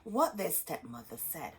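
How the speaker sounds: put-on lively read-aloud to kids; storybook reading. A narrator's reading. Teacher reading Hansel and Gretel aloud to children. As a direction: read the tale with gentle drama.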